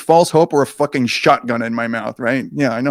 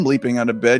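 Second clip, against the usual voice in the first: plain reading voice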